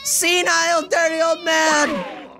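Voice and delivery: high, slow, full-lung yell; furious shout. Acted. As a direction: bellow it out at the top of your lungs. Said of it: "We plug our ears in reflex".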